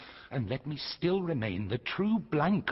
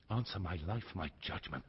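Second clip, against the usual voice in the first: low intense plea; 7 semitones lower, 12 dB quieter, faster than usual. Acted. Kent pleading quietly, intense.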